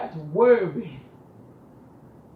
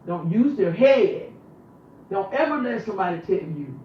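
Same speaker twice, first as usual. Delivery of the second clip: forceful preaching; 3 semitones higher, 7 dB louder than usual. Real, not acted. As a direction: hammer each word home like a preacher. Rising sermon-like indignation.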